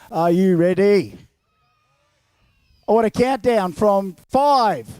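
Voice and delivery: rally the crowd from afar; hyping up the crowd with a loud call. Real, not acted. Calling out to crowd.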